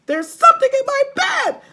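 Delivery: very high, loud panicking hag; panicked scream. Acted. Mrs Twit shrieking about something in her bed. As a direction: shriek it out in terror.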